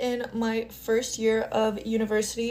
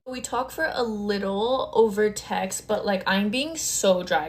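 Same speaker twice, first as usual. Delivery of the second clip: ordinary talk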